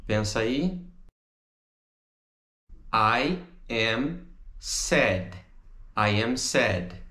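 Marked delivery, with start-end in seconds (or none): 2.9-5.4 I AM SAD, each word slow and separate. Shown as a demonstration. Teacher models the English answer, slow word by word. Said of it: "se a gente fosse contrair". 6.0-7.0 "I am sad" run together in one phrase; joined up. Shown as a demonstration. Teacher repeats the answer for students, joined up. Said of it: "se a gente fosse contrair".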